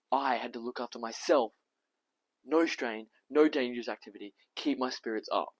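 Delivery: loud, emphatic list of instructions; mimicking doctor's orders. Tom repeating the doctor's rules on going home, loud and emphatic. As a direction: rap out each rule forcefully.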